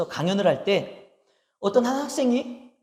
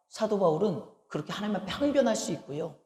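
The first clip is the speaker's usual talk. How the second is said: ordinary delivery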